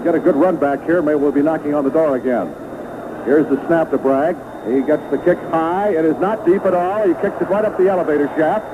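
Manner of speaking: normal talking voice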